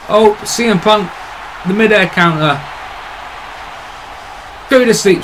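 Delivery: rising excited play-by-play; excited call. Real, not acted. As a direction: call the big counter with excitement. Calling a big wrestling move.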